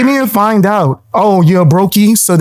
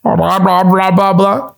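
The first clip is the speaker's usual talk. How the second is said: mock babble. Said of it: "That's what it sounds like".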